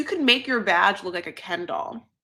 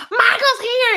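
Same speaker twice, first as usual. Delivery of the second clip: much higher and louder, thrilled announcement; excited squeal; 14 semitones higher, 7 dB louder, slower than usual. Retold or imitated. Wife announcing her husband's arrival, high excited squeal. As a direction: squeal it like you can't contain yourself. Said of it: "And she's like".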